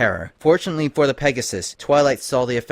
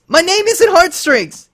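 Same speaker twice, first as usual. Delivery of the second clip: high, loud, slow breaking cry; anguished cry; 10 dB louder, slower than usual. Acted. Lyra finally breaking under the spell, anguished cry. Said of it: "she cried".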